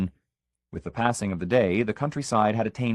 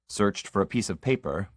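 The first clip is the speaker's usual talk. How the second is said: plain reading voice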